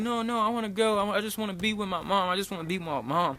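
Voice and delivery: high-pitched mock panicky child; freaking-out little kid. Scared little boy begging for his mom, mock panic. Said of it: "starts freaking the fuck out".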